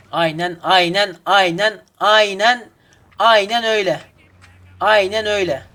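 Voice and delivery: sarcastic yes-yes building up; mock agreement. Real, not acted.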